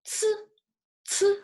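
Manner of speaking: "Ci" with a strong aspirated ts; tongue at the teeth, puff out "Ci" like the end of "students"; tongue-tip ci. Shown as a demonstration. Teacher showing students tongue placement, tongue-tip ci. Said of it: "tongues are touch the tip".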